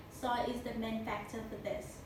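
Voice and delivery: normal talking voice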